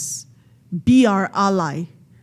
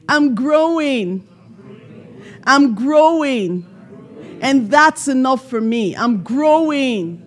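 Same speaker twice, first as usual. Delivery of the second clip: slow affirmation; 5 semitones higher, 4 dB louder than usual. Shown as a demonstration. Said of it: "Can you visualize it?".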